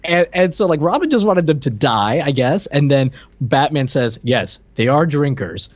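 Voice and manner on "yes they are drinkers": ordinary talk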